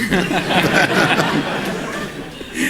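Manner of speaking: a loud burst of real laughter. Real, not acted. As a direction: laugh out loud at your own story. Laughs at own story.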